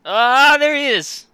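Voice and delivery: high delighted greeting; excited. Real, not acted. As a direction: greet the cat with glee. Cat appears on screen.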